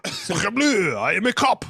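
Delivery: put-on French accent. Copies the French mock voice.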